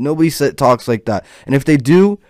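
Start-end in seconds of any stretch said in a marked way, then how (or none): none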